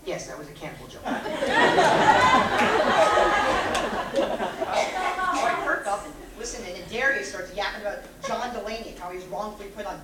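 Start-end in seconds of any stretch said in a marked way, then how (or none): 1.1-4.1 loud, high cackling laugh; loud cackle. Acted. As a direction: cackle hard at your own joke. Laughing at his own cannibal joke.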